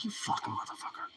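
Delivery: barely audible voiceless swearing; retold whispered exasperation; whispered curse. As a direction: swear under your breath at the pet. Muttered curse at the dog.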